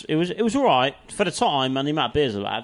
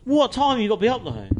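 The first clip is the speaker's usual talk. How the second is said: teasing challenge; 10 semitones higher, 3 dB louder than usual. Real, not acted. Teasing friend about work hours.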